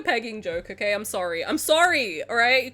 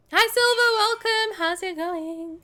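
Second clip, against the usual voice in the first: cheery greeting; 7 semitones higher, slower than usual. Real, not acted. Greeting a viewer.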